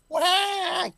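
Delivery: high mock baby wail; imitating a sobbing colleague with a wail. Politician mocking a crying colleague, high mock wail. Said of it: "cried three times".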